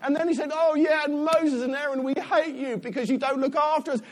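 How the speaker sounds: high, loud whingeing tirade; mock moaning. Preacher mimicking Israelites moaning at Moses, hammed-up whingeing. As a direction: pile up the complaints in a high, relentless moan. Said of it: "They just moaned".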